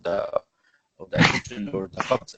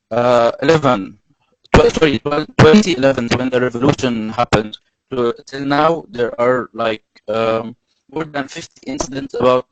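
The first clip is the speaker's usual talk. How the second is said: raised lecturing voice; speaking louder; 3 semitones higher, 7 dB louder than usual. Real, not acted. Telling listeners about incidents since the revolution, louder. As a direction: project while finding the words. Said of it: "I'll try to be louder".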